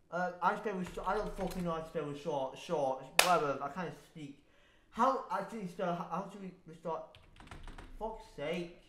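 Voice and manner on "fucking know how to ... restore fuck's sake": much softer mumble to self; muttering. Real, not acted. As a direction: mumble under your breath while typing. Muttering while searching.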